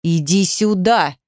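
slow, controlled menace; slow, restrained threatening command; quietly threatening. A narrator's reading. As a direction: draw out the order as a threat. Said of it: "угрожающе тихо".

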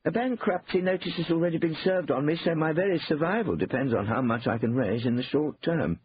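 earnest appeal over the phone; Cornelius, grave plight. A narrator's reading.

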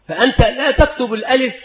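ordinary delivery

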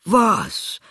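hoher, lauter Ausruf; ungläubiger Ausruf. Acted. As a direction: fassungslos aufschreien. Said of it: "rief der Vater". (ungläubig rufender Vater)

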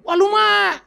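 high loud exasperated "wah"; high, loud mock exclamation; indignant outcry. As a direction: react outraged at the slur.

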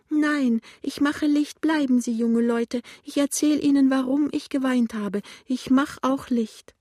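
high, insistent, tearful voice; plaintive old woman. A narrator's reading. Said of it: "sagt die klagende Stimme". (klagend und weinerlich, hohe Stimme)

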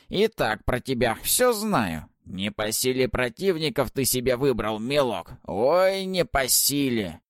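высокий громкий скрипучий голос ведьмы; поучай, как ехидная старая ведьма; голос Бабы-Яги. Acted. Баба-Яга отчитывает гостя. (громкий скрипучий голос старухи)

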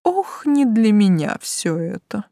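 slow, weary sigh of a line; tired. A narrator's reading. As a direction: draw it out wearily. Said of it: "устало заявил блондин". (устало, медленно)

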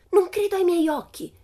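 very high, loud squeal of disbelief; piercing shriek. A narrator's reading. Pippi finding a treasure in the grass, shrieking. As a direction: shriek it in delighted amazement. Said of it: "gettò uno strillo acutissimo".

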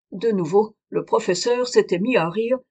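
usual speaking voice

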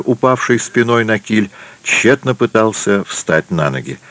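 plain reading voice

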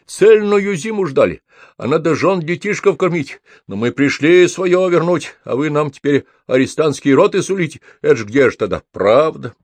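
high-pitched, aggrieved lament; plaintive wail easing down at the end; nearly sobbing. A narrator's reading. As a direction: complain tearfully to a superior. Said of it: "чуть не рыдая".

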